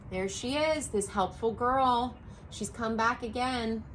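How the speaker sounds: plain reading voice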